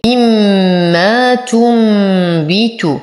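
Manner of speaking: تنبت with ن turned into a hummed "m"; very slow مما تنبت, both nasals held long; iqlab with ghunna. Shown as a demonstration. Teacher showing iqlab, drawn-out hummed "m" in مما تنبت. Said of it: "فالحكم إقلاب مع الغنة".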